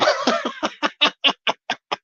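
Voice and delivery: short laugh at his own joke; breaking into a laugh after the bit; amused laugh. Real, not acted. Streamer laughing at his own Bristol impression.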